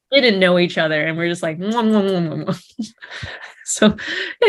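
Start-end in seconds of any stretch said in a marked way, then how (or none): 1.6-2.9 playful imitation of repeated kisses; mock kissing noises. Actor recalling early kissing scenes with strangers, mock kissing noises. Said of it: "all the kissing scenes".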